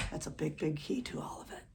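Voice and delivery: hushed, confiding whisper. Real, not acted. Drum teacher whispering the big key to students. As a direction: lean in and whisper the key point.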